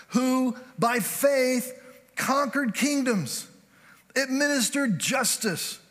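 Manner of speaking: slow, rising preacher cadence, pitch climbing; slow, climbing sermon read; building scripture reading. Acted.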